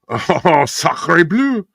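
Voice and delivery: put-on hammy French accent, high exclamation; French director voice. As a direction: exclaim like an over-the-top French filmmaker. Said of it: "the French director".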